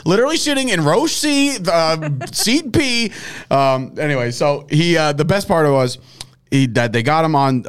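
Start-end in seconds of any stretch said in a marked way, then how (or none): 0.1-3.1 much higher, smug mock announcer voice; high-pitched mocking impression, slowing down at the end; imitating Schefter. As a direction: lampoon a reporter bragging about his scoop. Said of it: "He was just like".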